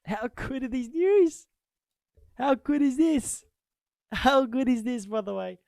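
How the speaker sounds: high, loud and drawn-out gloating; gleeful shout. Real, not acted. Liverpool fan crowing over the Thiago news, high and loud. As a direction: crow over the news, stretching each word.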